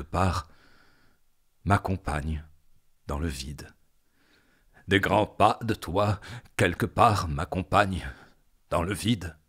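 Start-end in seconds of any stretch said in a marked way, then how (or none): 4.9-9.4 intense, insistent declaiming; more forceful. Acted. Poet followed by footsteps in the void, insistent. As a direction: drive the phrase on with mounting force.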